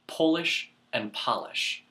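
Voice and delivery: Polish with a long o, then polish with a short a sound; two pronunciations. Shown as a demonstration. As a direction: say Polish like pole, then polish like doll, slowly. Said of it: "this next one".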